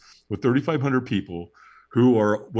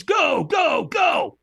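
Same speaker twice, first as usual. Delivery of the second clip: much higher, loud shouting go; shouted go; 4 dB louder, much slower than usual. Retold or imitated. Trainer shouting the firewalker off, loud and high. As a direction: yell him forward onto the coals. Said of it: "he goes".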